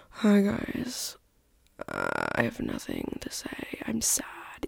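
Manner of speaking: whispered mock gloom; mock sad. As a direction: fake a limp, sad intro in a whisper. Imitating a bad uninspired episode.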